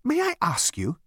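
high, polite elevator voice; sweetly reasonable. A narrator's reading. Elevator pleading with Zaphod, sweetly reasonable. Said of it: "in its sweetest, most reasonable voice".

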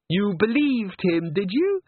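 high, slow, teasing question; mocking disbelief. A narrator's reading. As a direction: tease her slowly. Christine mocking Agnes.

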